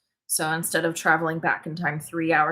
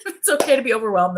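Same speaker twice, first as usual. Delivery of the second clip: usual speaking voice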